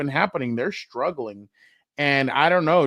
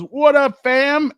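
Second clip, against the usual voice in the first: much higher and louder, a casual street greeting; Marshawn Lynch greeting; 11 semitones higher, 8 dB louder, much slower than usual. Retold or imitated. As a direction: toss out a big cheerful greeting like a star athlete. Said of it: "And he goes".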